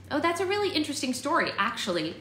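pleased, lifted pitch settling at the end; happily informing. A narrator's reading. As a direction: cheerfully share a fun fact. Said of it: "Bristol happily informed him".

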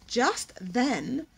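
ordinary delivery, nothing marked

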